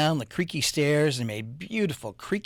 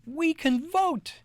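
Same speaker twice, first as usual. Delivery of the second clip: excited idea; slower than usual. Acted.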